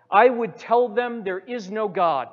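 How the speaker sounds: loud, proud boast; a pupil showing off his plan; eager demon. Retold or imitated. First demon answers Satan.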